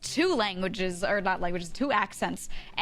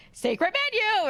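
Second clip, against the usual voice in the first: much higher, gushing tease; hammed-up higher hype voice; mock excited presenter; 13 semitones higher, 4 dB louder than usual. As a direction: squeal it like a hyped video host. Mocking hype of travel videos.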